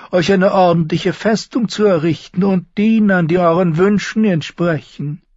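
high, smooth soothing courtier; mild Varys. A narrator's reading. Varys softening the dismissal, high and mild. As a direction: placate him gently in a high voice. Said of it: "milder als die anderen".

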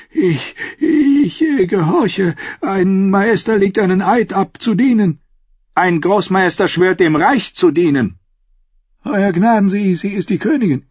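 put-on flustered, stammering old man; lightly voiced anxious old scholar; old maester. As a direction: scramble for an excuse. Maester stammers his defence.

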